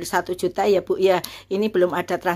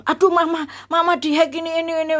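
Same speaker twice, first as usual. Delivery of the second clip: high-pitched retold alarm; sudden worried outcry, voicing the child; 9 semitones higher than usual. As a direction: raise the pitch sharply, alerting mother.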